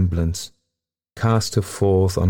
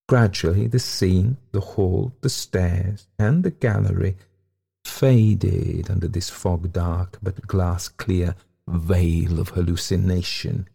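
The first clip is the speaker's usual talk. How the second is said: ordinary delivery, nothing marked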